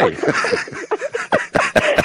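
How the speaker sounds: high breathy giggle; real laugh. Real, not acted. Host laughing along with Kathleen, high breathy giggle.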